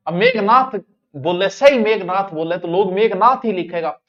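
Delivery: ordinary delivery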